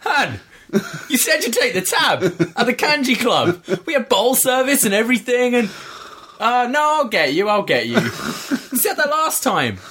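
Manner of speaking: Scottish gangster. Retold or imitated. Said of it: "this Scottish guy's like".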